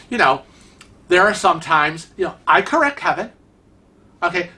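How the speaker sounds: ordinary delivery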